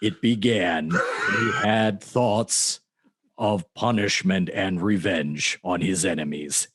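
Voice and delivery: low, drawn-out trailer narrator; movie trailer voice. Retold or imitated. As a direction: intone it slowly and deep like a trailer voiceover. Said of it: "gives this, like, really weird delivery, which is basically just like a movie trailer".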